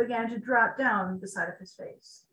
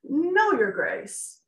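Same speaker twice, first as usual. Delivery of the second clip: nervous reply; 5 semitones higher, 5 dB louder, much slower than usual. Acted. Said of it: "Tiberius answered nervously".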